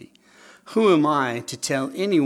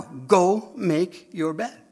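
slow, firm, raised Go make your bed; firm parental command; 5 semitones higher, 4 dB louder, much slower than usual. Retold or imitated.

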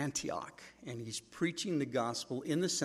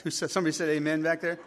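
fast, louder bit of teasing; playful callout to congregation; 4 semitones higher, 7 dB louder, faster than usual. Real, not acted. Pastor teasing a listener at the back, fast and amused. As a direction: catch the amen from the back, laughing.

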